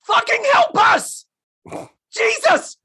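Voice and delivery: very high, loud mock yell; exasperated cry for help. Cohost acting what trapped girls could say, high exasperated shout. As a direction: shout it as a desperate plea. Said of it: "or you could say".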